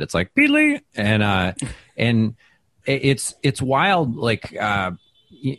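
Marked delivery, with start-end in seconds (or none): 0.4-0.8 Pete Lee squeaked high like a moped horn. Shown as a demonstration. Comic explaining his bit, honking his name like a moped horn. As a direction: honk your name like a little moped horn. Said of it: "sounds like the horn of a moped".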